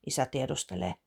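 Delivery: ordinary delivery, nothing marked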